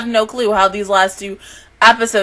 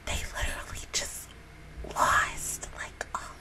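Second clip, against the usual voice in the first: stunned breathless whisper; 17 dB quieter than usual. Real, not acted. To viewers, reeling from the episode in a whisper. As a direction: barely get the words out. Overwhelmed by an anime death.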